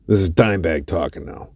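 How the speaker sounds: ordinary talk, nothing marked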